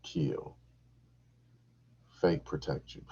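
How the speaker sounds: usual speaking voice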